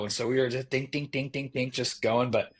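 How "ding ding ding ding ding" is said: rapid string of light, higher dings; imitated gunfire dings. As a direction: mimic the pinging of a small gun. Imitating suppressed gunfire in story.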